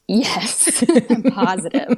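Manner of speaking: insist, then laugh through it; laughing insistence. Retold or imitated. Wife assuring husband her water broke, laughing insistence.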